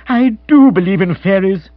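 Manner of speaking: loud, high exclamation of wonder; elated and emphatic; overjoyed. Acted. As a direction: proclaim it in happy amazement. Bill overjoyed at the news.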